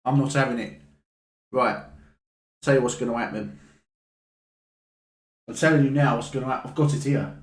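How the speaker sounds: a scary boss laying down the law to a cheeky customer; menacing hardman boss. Acted. Second character confronting, held voice.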